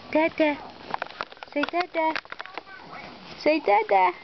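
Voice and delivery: high sing-song "Dada" prompting; coaxing baby talk. Shown as a demonstration.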